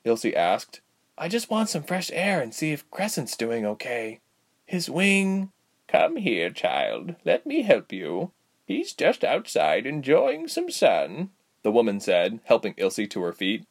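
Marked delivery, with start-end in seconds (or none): 1.2-5.5 put-on high girlish voice; young patient, pitched up; pleading young Ilse. A narrator's reading. 5.9-11.3 put-on high, drawn-out old lady voice; kindly aged healer, pitched up; consoling old woman. A narrator's reading.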